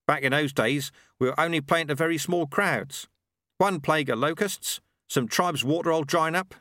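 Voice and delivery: a proud tradesman talking shop; Ronnie the milkman. Acted. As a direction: Ronnie the milkman, pleased with his product. Ronnie on small crowds.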